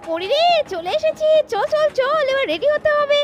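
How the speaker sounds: high, eager call to move; excited hurry. Acted. Woman excitedly rushing her friend at the station. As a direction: rush them along.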